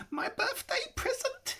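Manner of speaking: high creature voice with a gloating laugh; whispered to himself. A narrator's reading. Gollum gloating over his ring, high creature voice. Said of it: "he whispered to himself".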